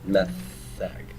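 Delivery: "metheg" with a soft th in the middle. Shown as a demonstration. Hebrew teacher showing the class the th sound, soft th in "metheg". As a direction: say "metheg" with a lingering th. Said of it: "So it's a th sound".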